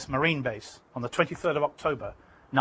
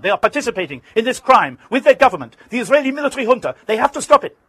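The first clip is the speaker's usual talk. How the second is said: high, loud and fast, furious; rising fury; 8 semitones higher, 10 dB louder than usual. Retold or imitated. Arafat's voice rising again at the reporter in fury. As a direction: let the anger surge up again. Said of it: "The voice was rising again".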